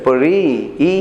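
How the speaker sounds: পড়ি with the final ই drawn out; stressed final i. Shown as a demonstration. Teacher showing students the sound in পড়ি, final ই held long. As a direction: stretch the ই ending of পড়ি, then ই alone. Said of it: "পড়ির সাথে কিন্তু ই আছে".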